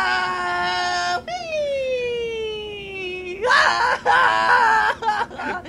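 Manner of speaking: very high mock scream then whee; rider screams. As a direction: scream down the drop. Coaster drops.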